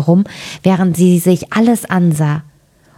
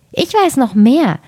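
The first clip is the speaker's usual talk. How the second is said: much higher, sly bird voice; knowing raven; 7 semitones higher than usual. A narrator's reading. Second raven revealing more, sly and high. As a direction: boast like a gossip raven. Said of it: "Da sprach die zweite".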